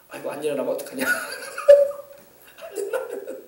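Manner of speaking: retold worry dissolving into giggles; imitated helpless laughing; laughing while talking. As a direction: crack up halfway through the words, voice squeaking away. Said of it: "본인도 웃더라고요".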